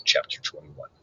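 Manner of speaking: soft whispered heading. Real, not acted. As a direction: whisper the chapter number.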